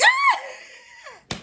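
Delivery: very high, drawn-out shriek of laughter; reenacted squealing outburst; high laughing shriek. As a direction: shriek as if helplessly amused. Reenacts her burst of loud laughter.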